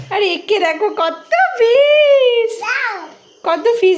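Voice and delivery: high, slow and loud. Real, not acted. Excited showing fish to toddler.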